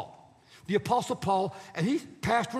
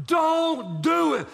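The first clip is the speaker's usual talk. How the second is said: slow, louder, higher warning; emphatic warning; 5 semitones higher, 5 dB louder, much slower than usual. Retold or imitated. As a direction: draw it out as a dire caution. Prophet telling you not to go.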